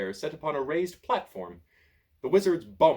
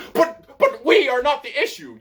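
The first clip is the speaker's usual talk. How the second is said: high, much louder yell; 13 semitones higher, 12 dB louder than usual. A narrator's reading. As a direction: yell it in a panic. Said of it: "yells Pothos".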